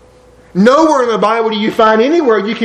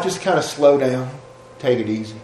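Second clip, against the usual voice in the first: soft advice; 5 semitones lower, 7 dB quieter than usual. Retold or imitated. Old man's quiet advice retold.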